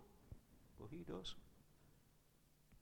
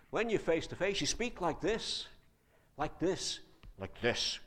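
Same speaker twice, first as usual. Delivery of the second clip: higher, louder, lively; animated face-to-face; 6 semitones higher, 8 dB louder than usual. Shown as a demonstration. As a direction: show full expression.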